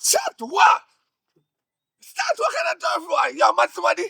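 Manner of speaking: high, shouted disbelief; outraged. Acted. Boss hearing of the shooting, outraged.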